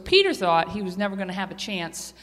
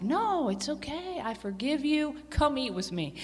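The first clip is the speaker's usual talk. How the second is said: gentle; 4 semitones higher, 6 dB quieter than usual. Acted. Jesus forgives Peter.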